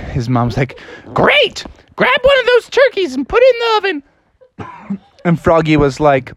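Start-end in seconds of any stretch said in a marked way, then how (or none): 1.1-5.0 very high mom voice; high-pitched, hammed-up mom; instructing mother. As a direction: call out orders in falsetto. Mother answers in her voice.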